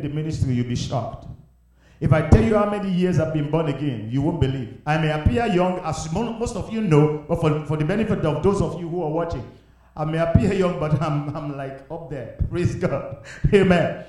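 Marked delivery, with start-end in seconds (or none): none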